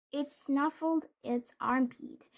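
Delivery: ordinary delivery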